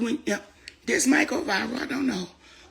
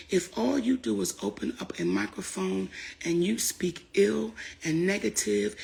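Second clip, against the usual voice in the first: ordinary talk, nothing marked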